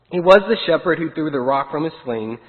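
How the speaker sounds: plain reading voice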